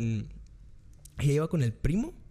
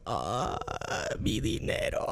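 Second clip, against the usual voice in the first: mock lament; 5 dB quieter, much slower than usual. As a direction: wail rising to falsetto. Girl leaves after he bought shots.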